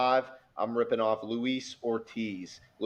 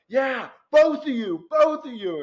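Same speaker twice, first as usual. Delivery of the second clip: fan yell; 13 semitones higher, 6 dB louder than usual. Retold or imitated. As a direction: holler it at the pitchers. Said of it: "We're like".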